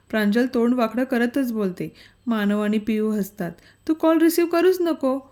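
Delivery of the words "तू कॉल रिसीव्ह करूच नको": laughing. A narrator's reading. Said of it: "मानव हसत बोलतो".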